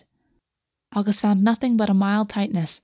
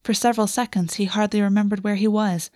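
usual speaking voice

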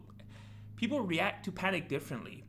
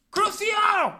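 high screaming spell cast; spell shout; 12 dB louder than usual. Acted. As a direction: scream the curse like a wizard. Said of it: "I will hit you with a Cruciatus curse".

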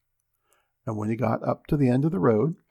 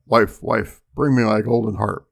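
louder ogre call; 2 semitones lower, 5 dB louder than usual. A narrator's reading. Ogre after breakfast ordering his wife, loud call. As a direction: raise the volume to summon the wife. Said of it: "the ogre called out".

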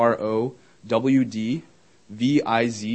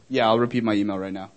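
ordinary delivery

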